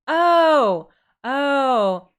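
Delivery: loud sudden oh of realisation; surprised exclamation. Real, not acted. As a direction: gasp out a loud oh.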